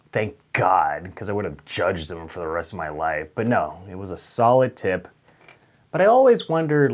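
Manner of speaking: ordinary delivery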